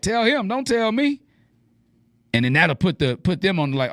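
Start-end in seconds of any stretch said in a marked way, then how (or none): 0.0-1.2 very high, rising playful deflection; light singsong brush-off; non-aggressive comeback. Shown as a demonstration. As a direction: toss it back high-pitched, without aggression. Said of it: "I'll say it like that".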